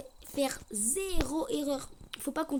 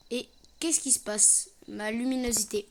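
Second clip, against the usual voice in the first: ordinary talk, nothing marked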